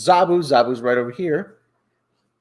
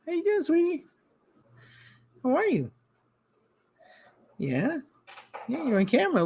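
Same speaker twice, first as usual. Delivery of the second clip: pet talk; 10 semitones higher, 5 dB quieter than usual. Real, not acted. Host greets his cat on camera, high cooing. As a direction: coo up high at the kitty.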